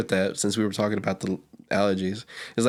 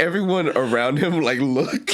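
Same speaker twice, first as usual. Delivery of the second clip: usual speaking voice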